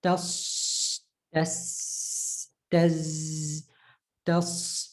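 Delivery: "tas" with a long hissed ending; whistling final consonant. Shown as a demonstration. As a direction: hold the end of each "tas". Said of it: "when you give it a sukoon".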